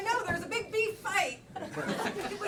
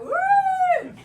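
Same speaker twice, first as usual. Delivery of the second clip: siren imitation; 12 dB louder than usual. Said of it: "So the cop came after me like".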